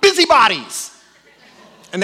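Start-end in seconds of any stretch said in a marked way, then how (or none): 0.0-0.9 high, loud shouted guess; group yelling out the answer. Retold or imitated. Women at a family game shouting the answer, loud. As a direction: blurt it out like a winning answer. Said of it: "And they all yell out".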